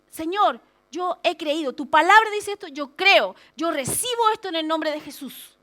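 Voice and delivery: loud, emphatic declaration of faith; oración de fe. Acted. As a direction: pray it out boldly, punching each claim. Modela una oración de fe firme. (declaración de fe enérgica)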